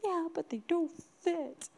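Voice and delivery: put-on high, pouting little girl; let-down child. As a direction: whine softly like a disappointed three-year-old. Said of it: "he failed me kind of thing".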